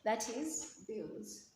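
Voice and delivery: bills drawn out slowly and pressed; stretched syllable. Shown as a demonstration. Teacher isolating the stressed syllable for students, slow and deliberate. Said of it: "stress the second part of abuse".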